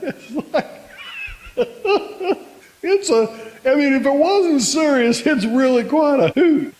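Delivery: high, giggling delivery; laughing through words. Real, not acted. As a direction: fight back laughter as you talk. Cracking up while retelling.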